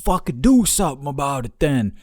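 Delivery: high, loud mock challenge; retold yelling. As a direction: imitate a man yelling a dare. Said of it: "started fucking yelling at him".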